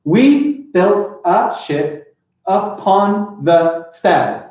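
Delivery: very slow line, each syllable a separate beat; deliberate, gapped syllables. Shown as a demonstration. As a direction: count out the verse beat by beat. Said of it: "look at the syllables in this".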